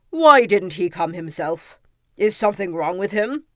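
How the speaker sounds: loud, low-starting royal challenge; challenging king. A narrator's reading. King questioning fox about the absent suitor, loud challenge. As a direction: question it loudly as a doubting king.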